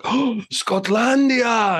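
high, slow, drawn-out call of Scotlandia; mock shout. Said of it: "And she shouted".

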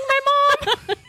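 laughing through the excuse. Retold or imitated. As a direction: giggle while you confess. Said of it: "And I was like".

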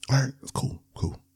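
hushed whispered resignation. Real, not acted. Giving in to his wife's insistence, whispered. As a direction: breathe it out, giving in.

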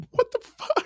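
a hammed-up shocked falsetto squeak; mock squeaky disbelief. Podcaster mocking a stunned producer, squeaky high disbelief.

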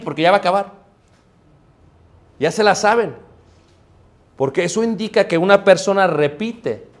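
normal talking voice

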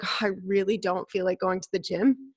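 low, quiet acted reluctance; reluctant inner voice. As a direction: voice a private, half-hearted grumble. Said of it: "in my mind, I'm like".

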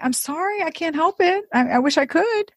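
high, helpless apology; wheedling, high-pitched sorry; pleading apology. Retold or imitated. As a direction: apologise with the voice lifted right up. Retells apologising to offended boyfriend.

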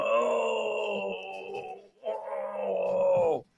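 high, soft mock groan of pain; hurt groan. Coach lying on the ice after a hit, high soft mock groan. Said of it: "He's going".

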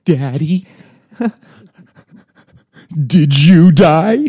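put-on high, loud, very slow child's question with laughter breaking in; a small kid asking, the teller cracking up; high toddler voice. Son asks, dad laughing.

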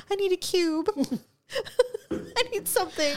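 high, whimpering pleas; whiny pleading. Pretend. Friend pretending she'd beg for ice after a bite. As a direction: whine it like a pampered patient. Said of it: "I've been like".

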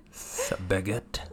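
slow French accent with a drawn-out s like a snake. Shown as a demonstration. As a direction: hiss the s slowly, in a French accent. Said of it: "Wanna see my French snake impression?".

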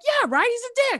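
very high, indignant fan voice; retold eager agreement. Young caller agreeing with idol about wrestler, excited squeal.

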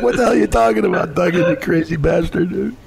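high-pitched baffled outburst; mock disbelief. Retelling his reaction to Duggan, high mock disbelief. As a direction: act stunned and half-laughing. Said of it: "I'm like".

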